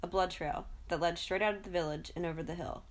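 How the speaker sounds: plain reading voice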